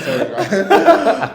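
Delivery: loud laugh through slow words; cracking up mid-sentence. Real, not acted. Laughing after the impression.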